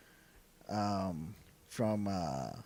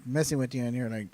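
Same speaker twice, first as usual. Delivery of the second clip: ordinary talk, nothing marked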